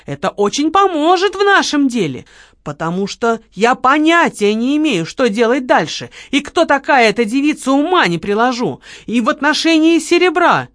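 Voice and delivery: high, loud mimicking of a sweet plea; mock his wheedling request; hammed-up sugary begging; mock pleading. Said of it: "попросить по-хорошему".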